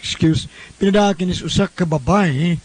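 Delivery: usual speaking voice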